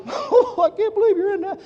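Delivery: high-pitched mock mother voice; mocking mom. Son imitating his mom doubting his business, mocking mom. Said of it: "my mom says".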